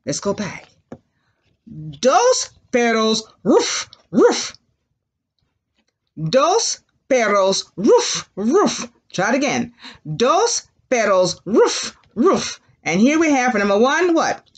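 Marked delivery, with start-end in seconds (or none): 3.4-4.5 two higher imitated barks; high doggy woofs. Shown as a demonstration. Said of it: "they say". 7.8-8.9 two imitated dog barks; short teacher's doggy woofs. Shown as a demonstration. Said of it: "they say". 11.4-12.6 two higher imitated barks; high doggy woofs. Shown as a demonstration. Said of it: "they say".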